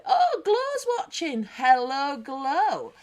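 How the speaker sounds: light up as you spot her; greeting a favourite viewer, thrilled and loud; delighted surprise. Real, not acted. Spotting a viewer joined.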